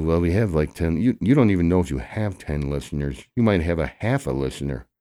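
ordinary talk, nothing marked

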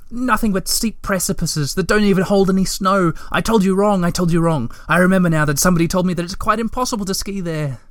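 high, hurried frantic protest; frantic pleading. A narrator's reading. Moomintroll frantically takes back his advice, high hurried pleading. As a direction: pour it out fast and beside yourself. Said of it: "beside himself now".

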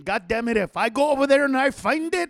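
angry dad. Retold or imitated. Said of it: "he would do this thing".

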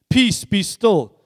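slow, loud command to the storm; Jesus stilling the sea, slow and forceful; commanding rebuke. A narrator's reading. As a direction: order the sea to calm, drawn out. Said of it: "he rebuked the wind".

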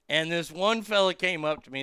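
normal talking voice

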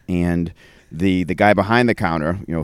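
ordinary talk, nothing marked

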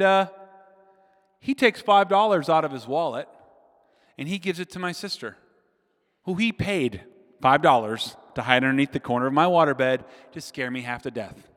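ordinary delivery

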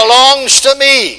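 loud, high, very slow call for the crowd to repeat; preaching call. Acted. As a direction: chant it out line by line. Call and response.

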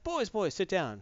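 gently gather the boys, lightly acted; Odin. Odin to his sons before the news, softly voiced. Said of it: "'cause he's like".

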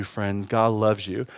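ordinary talk, nothing marked

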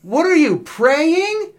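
high mocking shout of an older brother; mocking yell. Older brother yelling mockingly at the kid on his knees. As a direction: jeer it loud and high. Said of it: "I hear him yell".

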